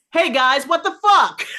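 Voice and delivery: high, loud, laughing; laughing mock outrage at friends; excited outburst. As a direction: blurt it out, cracking up. Retold message to friends, laughing.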